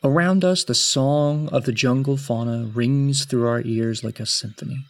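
measured, solemn tale-telling; story narration. A narrator's reading. Game master's formal scene opening.

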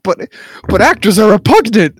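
loud, high mock disgust; imitated indignant outburst; Drax impression. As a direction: stammer into an outraged objection, hamming it up. Voices Drax's disgust at actors.

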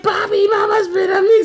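put-on high, loud tattling child voice; imitating a complaining kid. Dad voicing his son telling on mom: high, loud child whine. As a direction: shout it up high like a whining little kid. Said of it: "So Kyrie's like".

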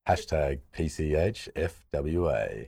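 slow seductive bedroom voice; drawn-out sultry sexy voice. Pretend. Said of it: "I want your best bedroom hashtag".

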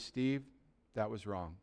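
very soft, low murmur; quiet, gentle correction, almost whispered; hushed voice of God nudging him; imitated whisper in the ear. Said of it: "He just whispers in my ear".

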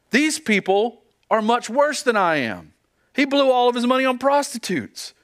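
put-on resentful older son pleading unfairness; complaining grumble. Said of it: "murmuring and complaining and wallowing".

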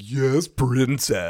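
slow, drawn-out mock deference; sarcastic. Demon hunter addressing the girl as princess, sarcastic. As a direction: draw out each word with sarcasm. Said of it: "he says it sarcastically".

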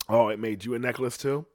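usual speaking voice

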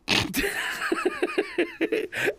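high hooting laugh. Real, not acted. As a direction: let out a big laugh. Big laugh.

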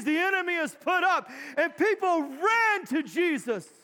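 loud, high, rousing sermon delivery; full-force preaching. Real, not acted. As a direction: preach it to the whole church, punching each what-if.

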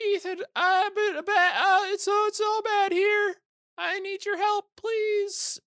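very high, loud mock panicked woman; hammed-up high frantic plea for help; imitating panicked wife. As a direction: squeal it like a damsel in distress. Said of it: "she's going".

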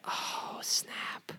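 whispered, stunned aside; barely voiced, taken aback; hushed reaction. Real, not acted. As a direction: whisper it under your breath. Stumped by a hard question.

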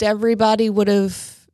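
ordinary talk

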